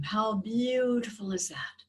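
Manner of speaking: slow, calm meditation voice; guided meditation. Acted. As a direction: gently guide their breathing. Leads a breathing meditation.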